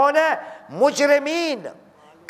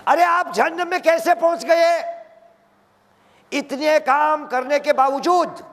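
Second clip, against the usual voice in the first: astonished question; 6 semitones higher than usual. Acted. Dwellers of paradise ask in surprise.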